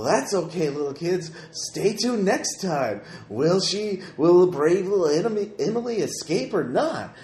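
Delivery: put-on hammy kids' TV host; a cheesy children's show announcer; Pirate Percy. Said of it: "Pirate Percy's voice".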